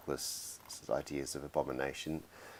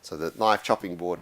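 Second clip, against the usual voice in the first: normal talking voice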